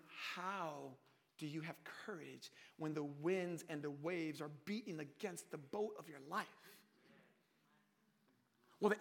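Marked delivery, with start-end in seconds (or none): 0.1-6.5 low, quiet wondering; hushed inner doubt. Acted. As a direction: think it aloud softly, pitched low. Voices listeners' troubled inner thought.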